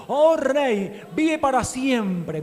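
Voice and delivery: loud, high joyful cry; effusive glory voice. A narrator's reading. Daniel answering the king from the den, loud and joyful. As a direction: exult as you greet the king. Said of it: "una voz efusiva".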